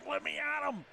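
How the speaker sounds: put-on eager kid at the top of the stairs; mock impatient child begging for gifts; lightly voiced greedy kid; excited kid. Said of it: "like a kid on Christmas at the top of the stairs".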